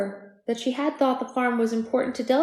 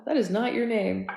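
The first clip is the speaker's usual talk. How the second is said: usual speaking voice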